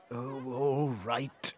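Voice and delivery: soft, slow, weary sigh of a line; sighing king. Acted. King gives in to the queen, with a soft slow sigh. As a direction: give in with a tired sigh. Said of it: "sighed the king".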